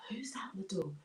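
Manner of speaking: soft whispered question; acted hushed wondering. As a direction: whisper it warily toward the door. Farmer answers knock, hushed.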